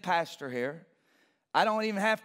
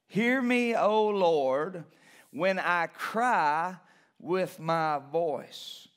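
slow down and lean on cry; slow emphatic reading; 3 semitones lower, much slower than usual. Acted. Preacher rereading the verse, slow and emphatic. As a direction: cry stretched, long and slow. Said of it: "translates verse number seven like this".

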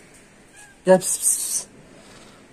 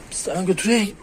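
ordinary talk

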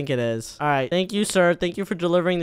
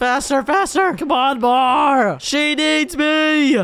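desperate shout; 8 semitones higher, 4 dB louder, much slower than usual. Acted. Pushing blanket over the sun again.

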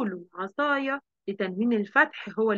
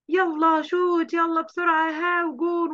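put-on higher, louder calls of kids at play; excited cheering; 6 semitones higher, 3 dB louder than usual. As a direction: cheer the team on as it shoots for a goal. Kids cheering during football.

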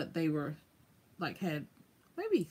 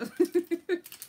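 amused laugh; 10 semitones higher, 4 dB louder than usual. Real, not acted. Laughing at the husband's order.

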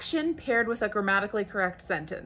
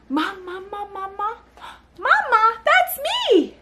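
delighted parent reply; 11 semitones higher than usual. Shown as a demonstration. Parent answers babbling as if talking to her, excited. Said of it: "And you say".

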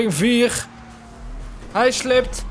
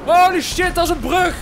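shocked shout. Real, not acted. Nearly hits bridge.

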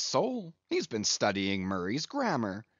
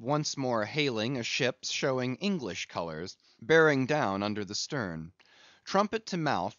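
usual speaking voice

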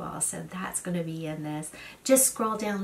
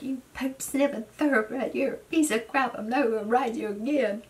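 retold angry shout; 5 semitones higher than usual. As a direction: scold the horse like you've had it. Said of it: "I just got so mad at him".

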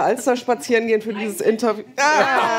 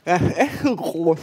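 cork in mouth; talking around a cork; mouth full; 3 semitones lower, slower than usual. Real, not acted. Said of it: "das spricht eher undeutlich".

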